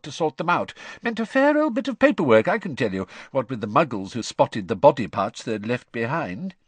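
lightly voiced chatty dad; easygoing father explaining; cheery father voice. A narrator's reading. Father continues story.

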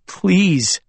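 begging. Acted. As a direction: beg him to stay. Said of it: "I realized I was begging".

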